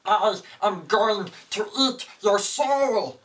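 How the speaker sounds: high, slow, strained monster voice; weak cowering monster. Shown as a demonstration. Teacher showing the wrong stance for a monster, high puny voice. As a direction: squeeze out a puny monster threat, drawn out. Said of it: "you're probably gonna sound like this".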